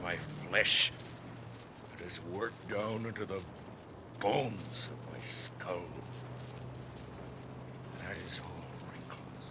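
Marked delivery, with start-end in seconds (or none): 2.3-9.5 hushed, breathy aside; whisper. Acted. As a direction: whisper it close to his ear. Ahab whispers to blacksmith.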